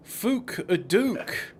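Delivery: FOOK and DUKE drawn out very slowly with long oo; exaggerated vowels. Cook showing off the oo vowel to friend, exaggerated vowels. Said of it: "pronouncing his words differently to a southerner".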